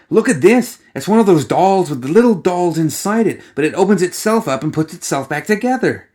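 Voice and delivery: put-on a playful young woman needling her companion with constant chatter; bubbly teasing girl gawking at curiosities; Alice, chattering. A narrator's reading. Alice excited by the nesting doll.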